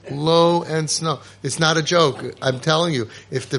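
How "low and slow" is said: drawn out, very slow and steady; low and slow. Shown as a demonstration. Father showing parents how to speak, very slow. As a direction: stretch each word, calm and unhurried. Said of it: "have to talk low and slow".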